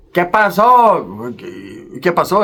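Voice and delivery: slow, drawn out Mexican Spanish call; paisa calling out. Retold or imitated. Paisas calling out to the narrator, drawn out.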